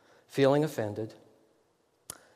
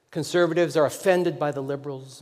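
usual speaking voice

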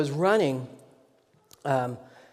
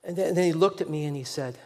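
usual speaking voice

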